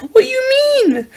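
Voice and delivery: shrill chipmunk squeal; squeaky high voice. Acted. High-pitched squeaky reaction voice.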